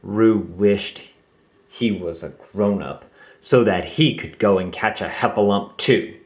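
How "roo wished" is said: plain reading voice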